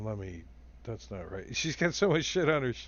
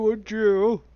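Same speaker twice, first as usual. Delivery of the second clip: loud, higher acted outburst; exasperated shout; 7 semitones higher, 10 dB louder than usual. Game master voices attacker stabbing the foe, shouting.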